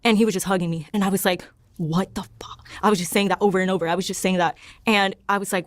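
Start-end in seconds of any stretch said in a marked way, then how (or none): 1.8-2.7 hushed, whispered disbelief; retold breathless-quiet shock; stunned whisper. Stunned retelling of own reaction.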